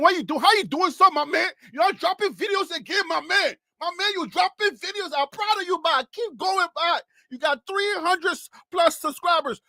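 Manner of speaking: put-on high-pitched, excited Liberian accent. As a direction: cheer him on in an animated Liberian accent. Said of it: "That was for all my Liberian folks".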